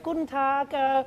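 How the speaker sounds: high-pitched, polite German server. Acted. German server greeting a customer, politely. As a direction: pitch it up as a hesitant waiter. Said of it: "someone would be there and be like".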